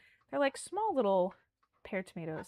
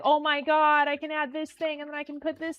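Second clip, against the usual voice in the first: eager, a touch higher self-quote; imitating excitement; 5 semitones higher, 4 dB louder than usual. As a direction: replay the rush of new cooking ideas. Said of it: "you get really excited".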